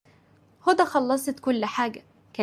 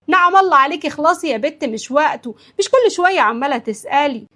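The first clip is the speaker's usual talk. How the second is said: loud, raised-pitch telling-off; exasperated scolding; 4 semitones higher, 8 dB louder than usual. Acted. Mother to daughter who keeps asking, exasperated scolding. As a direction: cut her questions off impatiently.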